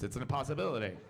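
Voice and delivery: usual speaking voice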